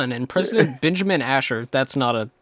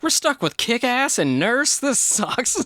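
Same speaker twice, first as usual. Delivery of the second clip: high, slow mock whine; mock whiny audience; 9 semitones higher, slower than usual. Imitating disappointed viewers, high mock whine. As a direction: whine it up high like a let-down fan. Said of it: "they were just like".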